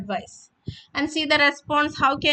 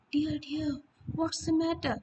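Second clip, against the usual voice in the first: much softer, drowsy and slow; sleepy, softer, slow read; 3 semitones higher, 8 dB quieter than usual. Shown as a demonstration. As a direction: say it like you're half asleep. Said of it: "So, very slowly, in a sleepy voice".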